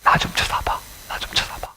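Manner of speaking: gentle whispered plea; tender whisper. Retold or imitated. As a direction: breathe it softly, coaxing someone to look up. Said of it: "고개를 이렇게 돌리시면서". (부드럽게 속삭이는 하나님의 목소리)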